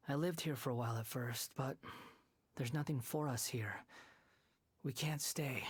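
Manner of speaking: normal talking voice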